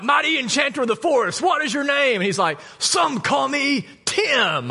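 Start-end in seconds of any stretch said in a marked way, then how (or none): 0.0-2.2 high, hammed-up questing knight; Monty Python knight. Pastor quotes the Holy Grail knights asking a name, high and hammy. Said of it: "Monty Python and the Holy Grail skit". 2.8-4.7 very slow, high, drawn-out mock wizard; Tim the Enchanter. Pastor imitates Tim the Enchanter answering, very slow and high. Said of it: "Monty Python and the Holy Grail skit".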